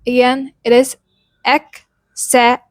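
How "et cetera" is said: et and cetera said apart, slowly; et cetera split into two slow pieces. Shown as a demonstration. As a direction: break et cetera into its parts. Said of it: "another word was et cetera".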